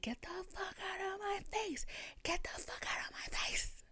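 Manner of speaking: high, strangled mock scream, kept soft; retold screaming. Retelling her screaming at the hipster, strained whisper-scream. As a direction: mime screaming at the top of your lungs without the volume. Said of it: "I started screaming".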